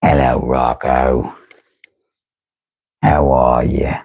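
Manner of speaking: low, slow cartoon neighbor; low dull cartoon neighbor. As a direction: drop down low and drawl it out. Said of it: "where I get my accent".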